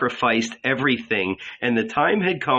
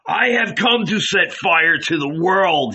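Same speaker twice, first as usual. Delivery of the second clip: higher, louder proclamation; fiery shout; 5 semitones higher, 3 dB louder than usual. A narrator's reading. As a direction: declare it like a blaze. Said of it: "he shouted".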